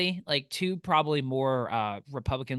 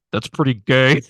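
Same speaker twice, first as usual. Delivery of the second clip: much louder imitated blurt; loud heckle; 3 semitones lower, 11 dB louder than usual. Imitating the guy heckling the sad scene, very loud. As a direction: break the sad moment with a booming comment. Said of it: "really loudly".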